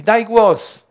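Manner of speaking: loud high dog command, daj punched. Retold or imitated. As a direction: call to the dog to speak. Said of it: "do pieska się tak mówi".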